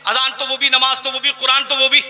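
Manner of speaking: normal talking voice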